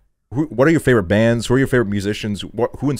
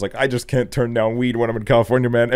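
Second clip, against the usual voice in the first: ordinary talk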